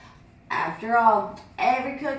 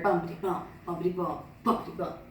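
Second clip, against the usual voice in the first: lower, softer stick bumps; hushed sound effect; 5 semitones lower, 5 dB quieter, faster than usual. Acted. Gracie faking the master's stick, hushed. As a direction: tap it out quietly, sneaky.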